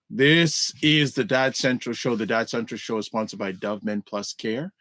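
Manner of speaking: ordinary delivery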